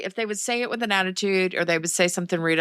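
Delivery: ordinary delivery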